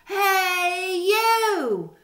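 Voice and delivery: high, loud squeaky challenge; grouchy ladybug. A narrator's reading. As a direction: pick a fight in a tiny high voice. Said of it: "said the grouchy ladybug".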